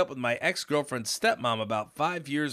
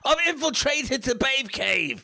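loud, high, slow mock Aussie-British voice; over-the-top fake Australian-British accent, loud and high; bad Australian accent; 13 semitones higher, 4 dB louder, slower than usual. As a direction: ham up a botched Australian accent. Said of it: "he has a regular Long Island accent, but in person, it's Australian".

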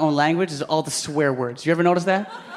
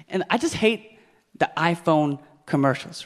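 ordinary talk

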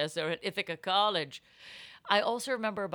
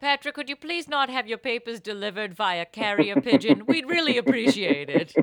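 bad acting; 3 semitones higher, 7 dB louder than usual. Teacher voiced, mock stern.

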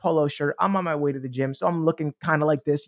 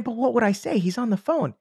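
higher, worried inner voice; anxious doubting voice; 7 semitones higher than usual. Acted. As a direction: fret like your cautious side. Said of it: "that voice came up again".

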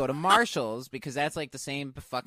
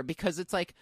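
ordinary talk

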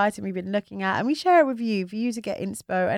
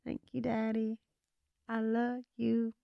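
sweet coy voice; 3 semitones higher, 10 dB quieter than usual. Pretend. Acted sweet accepting voice.

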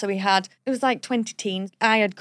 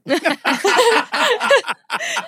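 high, loud burst of laughter; helpless cackle; real giggling laugh; 10 semitones higher, 5 dB louder than usual. Real, not acted. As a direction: crack up at your own admission. Laughs after admitting toilet trip.

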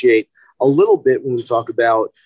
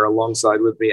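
usual speaking voice

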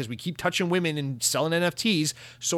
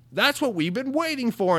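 much higher, cartoonish cry of relief; cartoon customer voice; 10 semitones higher than usual. Customers cheering SpongeBob's grilling, cartoonish.

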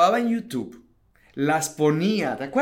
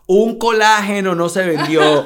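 emphatic; 4 semitones higher, 11 dB louder than usual. Real, not acted. Emphatic no sales.

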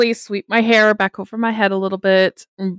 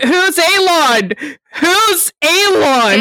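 mock outrage; 8 semitones higher, 3 dB louder, slower than usual. Players mocking the wrong name.

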